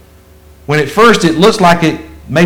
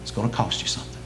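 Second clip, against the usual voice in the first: quiet warning; 7 semitones lower, 16 dB quieter, much faster than usual. Acted. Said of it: "You wanna follow me?".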